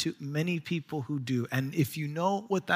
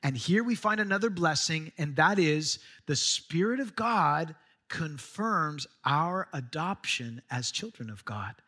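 ordinary talk, nothing marked

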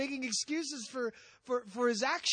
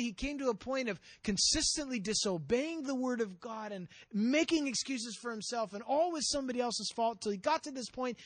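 ordinary talk, nothing marked

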